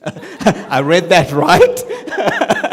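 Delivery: reading it out through real laughter; laughing. Real, not acted. Host introducing speaker, laughing at the book title. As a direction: laugh while checking you read it right.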